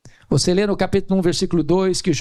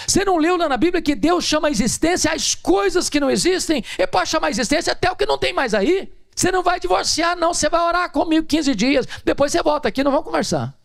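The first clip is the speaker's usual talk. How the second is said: preaching at full force; 12 semitones higher than usual. Acted. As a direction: rise into a pulpit climax.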